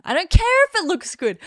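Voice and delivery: loud, high defiant outburst; defiant shout. Real, not acted.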